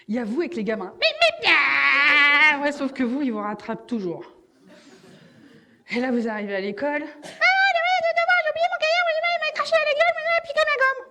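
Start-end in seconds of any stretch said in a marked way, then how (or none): 1.0-2.6 cartoon beep beep. Cartoon Road Runner noise. 7.1-11.0 put-on very high, squeaky little kid voice; squeaky child voice. Child back from school telling mum about the day, in a squeaky high voice.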